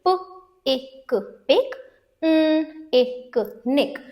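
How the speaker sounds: "प, एक, पिक, न, एक, निक" slowly, with gaps; sounding out syllables. Shown as a demonstration. Teacher reads picnic for learners, sounding out each syllable. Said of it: "इसे read करेंगे".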